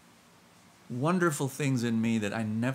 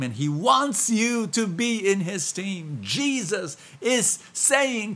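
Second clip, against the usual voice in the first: louder, higher, slow and swelling; preaching at full force; 6 semitones higher, 5 dB louder, slower than usual. Acted. Pastor to congregation, rising into loud preaching. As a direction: lift it into a stirring call.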